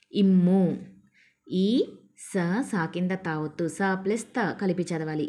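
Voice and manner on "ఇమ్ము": "ఇమ్ము" loud, with a held double m; pressed doubled consonant. Shown as a demonstration. Teacher showing learners the doubled m, pressed loud "ఇమ్ము". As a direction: lean hard on the mm in "ఇమ్ము". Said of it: "ఒత్తి పలికి చదవాలి".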